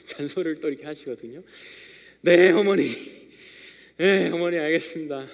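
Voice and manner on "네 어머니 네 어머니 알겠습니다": put-on a loud, offhand "yes, mother" from a kid tired of nagging; imitating a child who isn't really listening; bored dutiful child. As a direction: brush the nagging off with mock politeness. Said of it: "잔소리 듣기 싫어하는 아이처럼".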